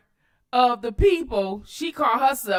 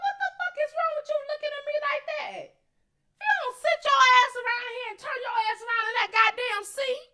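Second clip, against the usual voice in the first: high-pitched mock scolding; imagined cussing-out in a high, strained rush; imagined telling-off; 11 semitones higher than usual. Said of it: "That's what I would've said".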